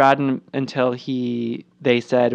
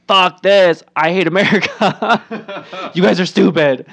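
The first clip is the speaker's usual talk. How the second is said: pretend outburst; 4 semitones higher, 5 dB louder than usual. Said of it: "I want her to be like".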